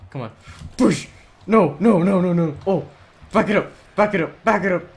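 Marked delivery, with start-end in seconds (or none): 0.8-2.9 loud, high repeated protest; panicked shouts. Real, not acted. Panicking during play. 3.3-4.8 loud, high repeated command; urgent shouting. Real, not acted. Urging own car back.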